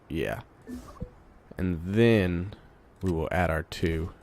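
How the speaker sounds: ordinary talk, nothing marked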